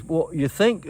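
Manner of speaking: put-on Cockney accent. Tour manager asking about the announcement, light Cockney accent. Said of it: "he has this wonderful Cockney".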